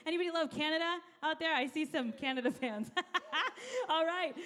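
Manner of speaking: normal talking voice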